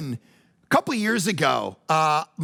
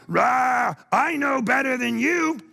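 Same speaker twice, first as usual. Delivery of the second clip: stubborn brat; 6 semitones higher, slower than usual. Retold or imitated. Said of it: "You're going".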